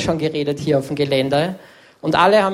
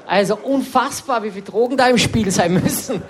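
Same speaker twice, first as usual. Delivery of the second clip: usual speaking voice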